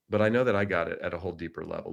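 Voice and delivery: ordinary talk, nothing marked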